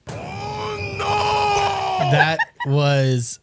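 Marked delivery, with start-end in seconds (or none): 0.0-2.1 very high squealing laugh; laughing shriek. Real, not acted. Cracking up after the reading.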